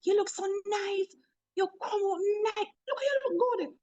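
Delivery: high, soft gushing compliments; delighted praise. Retold or imitated. Boss praising transformed coworker, soft high gushing. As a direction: gush over how fly she looks. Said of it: "I went to her and I said".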